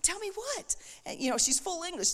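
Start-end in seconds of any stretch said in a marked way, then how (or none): 0.0-0.8 very high, re-enacted eager question. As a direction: ask it high and agog, as if retelling. Acting out her eager reply.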